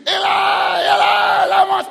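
high, sustained full-force scream; screamed cry. Retold or imitated. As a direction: cry out very loud and drawn-out. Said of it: "he just screamed like that".

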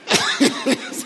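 real breathy chuckle; breathy laugh. Real, not acted. Preacher amused by his failed smoking attempt, breathy laugh. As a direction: laugh at your own story.

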